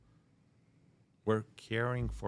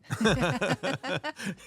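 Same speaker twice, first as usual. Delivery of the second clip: high, loud burst of laughter; amused laugh; 8 dB louder than usual. Real, not acted. Guest laughing about an unplanned career, amused laugh. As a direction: laugh out loud at your own answer. Laughs at own coincidence answer.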